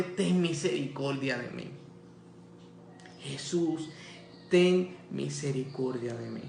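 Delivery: low, hushed appeal with pauses; subdued plea. Retold or imitated. Bartimeo begs Jesus for mercy, subdued plea.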